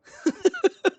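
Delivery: short high loud laugh; a quick bright burst of laughter. Real, not acted. Player laughing while taking off his beanie.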